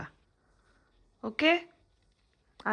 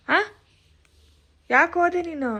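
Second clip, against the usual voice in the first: higher-pitched scolding question; 7 semitones higher, 4 dB louder than usual. Real, not acted. Mother scolds child for going downstairs. (ಗದರಿಸುವ ಧ್ವನಿ)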